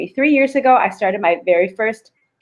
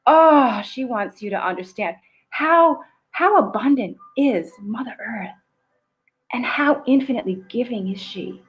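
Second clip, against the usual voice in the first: guided visualization; 4 semitones higher than usual. Acted. Coach to live audience, guiding a Mother Earth visualization, soothing. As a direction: paint Mother Earth for them, unhurried.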